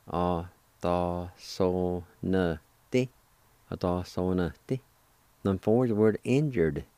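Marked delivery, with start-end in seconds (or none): 0.1-3.1 "A da so ne ti." stretched slowly; slow, careful teaching pronunciation; slow demo. Shown as a demonstration. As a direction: draw out every syllable of "A da so ne ti.". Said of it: "it's pronounced like this".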